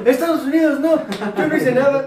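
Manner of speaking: laughing mock. Mocking a friend's excuse.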